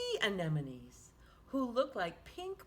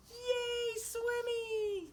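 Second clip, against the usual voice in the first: high, louder cheer; 13 semitones higher, 4 dB louder, much slower than usual. Acted. Teacher cheering Swimmy's win for the children, high happy cheer. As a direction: cheer like a kid at the finish.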